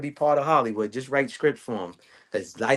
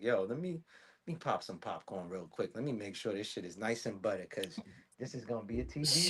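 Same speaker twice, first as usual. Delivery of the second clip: much softer and lower, a private mutter to himself; muttered aside; 7 semitones lower, 15 dB quieter than usual. Retold or imitated. Guy muttering while she rants on the phone, much softer aside. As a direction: say it under your breath as she talks. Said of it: "I'm like".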